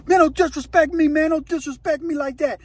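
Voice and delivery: high-pitched retold anger; acted indignant protest. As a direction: push back as the insulted inmate. Acts out offended inmate's protest.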